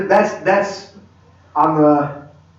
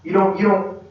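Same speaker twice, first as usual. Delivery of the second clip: ordinary talk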